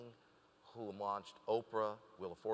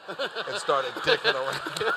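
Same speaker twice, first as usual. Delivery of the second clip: laughing; 10 semitones higher, 13 dB louder, slower than usual. Real, not acted. Laughing while retelling own move.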